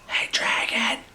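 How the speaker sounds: soft unvoiced whisper. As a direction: whisper to the sleeping dragon. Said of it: "Elizabeth whispered very softly".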